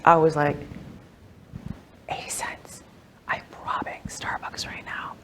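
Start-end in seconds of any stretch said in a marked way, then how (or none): 2.1-5.1 hushed whisper, very soft. Retold or imitated. Retelling her delight at the cheap drink, in a soft whisper. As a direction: whisper it like a secret steal.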